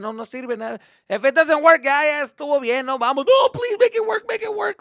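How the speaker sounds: high, loud frantic pleading; desperate dad. Retold or imitated. Dad begging the credit card to work, high and frantic. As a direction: beg the website to go through.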